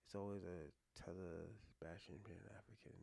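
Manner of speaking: whispered reread of the message, no voice. Real, not acted. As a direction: breathe the words out puzzled, barely audible.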